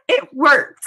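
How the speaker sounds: a laugh breaking through the words. Real, not acted. Teacher recalling her scholarship win, laughing. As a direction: laugh it out, proud and amused.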